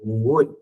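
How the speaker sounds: Would stretched with two U sounds, ending in D; drawn-out pronunciation. Shown as a demonstration. Teacher corrects students saying good, drawn-out would. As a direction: lengthen the U in would and land on the D. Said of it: "No hay sonido de G, sino que es".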